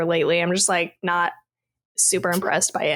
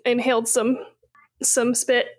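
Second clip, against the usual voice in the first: throat still catching after choking; just choked; 4 semitones higher than usual. Real, not acted. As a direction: talk right after choking on spit. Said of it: "I was choking on my".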